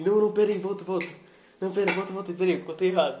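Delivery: a comedian scrambling to restart; flustered comedian. Retold or imitated. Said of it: "ele erra assim". (comediante afobado, mais alto)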